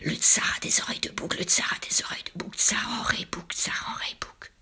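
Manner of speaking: fast repeated whisper, fading away; hushed, hurried echoing rumour; spreading whispered rumour. A narrator's reading. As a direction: whisper it quickly like words carried on the wind. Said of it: "se répandre dans l'air à travers village".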